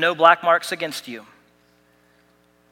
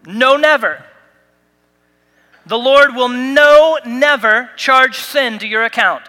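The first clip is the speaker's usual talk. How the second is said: louder, higher preaching at full force; fervent preaching; 5 semitones higher, 6 dB louder than usual. Acted. Pastor urging his congregation that sin is never charged, fervent preaching.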